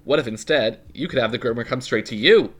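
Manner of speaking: bright energetic sponsor pitch; ad read. Acted. Co-host reads the groomer ad to listeners. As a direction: pitch it like a commercial.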